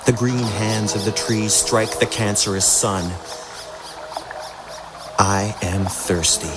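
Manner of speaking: usual speaking voice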